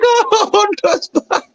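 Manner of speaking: high-pitched, laughing fan delight; super happy. Retold or imitated. Viewer reliving Hondo's return, high giddy excitement. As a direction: squeal it through a laugh, re-enacting your joy. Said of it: "I was super happy".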